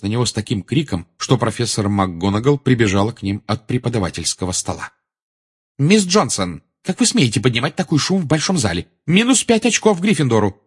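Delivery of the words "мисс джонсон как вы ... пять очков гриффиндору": high, indignant teacher's reprimand; stern scolding. A narrator's reading. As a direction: tell the pupil off in a raised pitch. Professor scolds noisy pupil. (строгий, возмущённый выговор)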